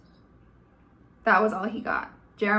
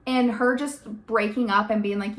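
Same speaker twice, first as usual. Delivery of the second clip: ordinary delivery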